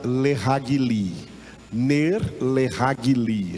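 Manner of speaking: "leragli" said slowly as le-ra-gli, r tapped; "Ner, leragli" spelled out slowly, each syllable clear; Hebrew pronunciation. Shown as a demonstration. Teacher models "Ner, leragli" slowly for the class to repeat. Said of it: "Repita, repitam".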